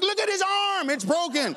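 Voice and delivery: high, exasperated protest. Retold or imitated. Pastor exasperated at his own doubts, high protest. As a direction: point at the obvious, worked up.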